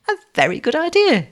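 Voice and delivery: light up with merriment, pitch lifted high; merry. A narrator's reading. Girl welcomes the young man's plan, merrily. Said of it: "The girl laughed out merrily".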